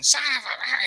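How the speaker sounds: high-pitched mock cursing; imitated angry swearing. Teller as woman mad at fallen boater, mock swearing. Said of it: "she's swearing".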